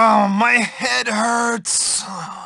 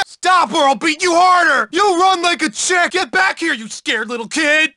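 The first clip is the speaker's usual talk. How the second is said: chasing shout; 4 semitones higher than usual. Acted. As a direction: bellow after a fleeing kid.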